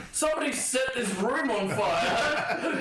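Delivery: high, slow, drawn-out cry of fire; hammed-up shouted warning; mock alarm shout. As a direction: raise a play-acted alarm through the building. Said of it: "I start shouting".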